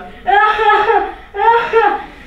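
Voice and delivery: high, loud uncontrollable laughing; Henry can't stop laughing at mum; helpless giggles. Acted. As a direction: burst into a fit of giggles. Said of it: "de slappe lach".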